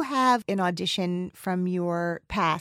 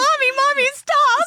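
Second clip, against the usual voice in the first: put-on very high, loud child's cry; pleading little girls; 5 dB louder than usual. Little girls beg mommy to stop, pleading child voice. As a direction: beg like small daughters tugging at their mother. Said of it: "Your little girls have their arms".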